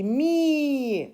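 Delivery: MI held long on one high, falling breath; demonstrating mi as one long syllable. Shown as a demonstration. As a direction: stretch mi out in a single sustained sound. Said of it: "d'une seule émission de voix".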